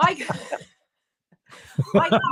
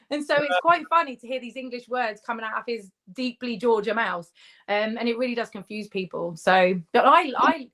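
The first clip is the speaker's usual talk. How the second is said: usual speaking voice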